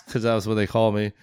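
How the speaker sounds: normal talking voice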